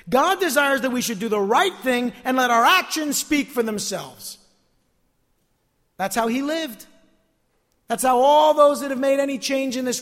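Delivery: loud, high-pitched exhortation; ringing pulpit emphasis; full-force preaching. Real, not acted. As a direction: proclaim it to the whole congregation.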